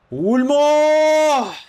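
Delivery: long, high, stretched-out shout; excited yell. Real, not acted. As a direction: let the word ring out in excitement. Excited moment in match.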